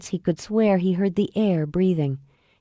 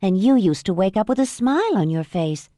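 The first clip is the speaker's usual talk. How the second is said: Mom, teasing. Acted. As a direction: needle him gently. Mom teasing sleeping son.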